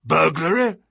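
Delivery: cried out. A narrator's reading. Colonel with coffee cup in mid-air, crying out. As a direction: cry out in surprise. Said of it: "cried the Colonel".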